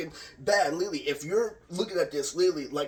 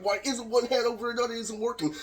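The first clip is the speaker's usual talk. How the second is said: high, incredulous retold complaint; mock bafflement, pitched up; half-acted frustration; frustrated; 7 semitones higher than usual. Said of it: "I'm like".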